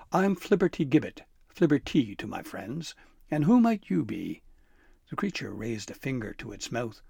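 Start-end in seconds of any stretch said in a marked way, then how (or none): 0.1-4.4 higher, louder impish sprite; cocky elf voice. Acted. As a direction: play the sly elf. Elf introduces himself.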